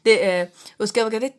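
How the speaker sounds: normal talking voice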